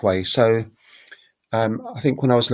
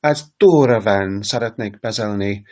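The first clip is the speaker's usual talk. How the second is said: "Dora" stretched and stressed near the start; Hungarian initial emphasis. Shown as a demonstration. Teacher showing Hungarian sentence emphasis, strong stress on "Dora". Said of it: "The emphasis tends to go on the beginning".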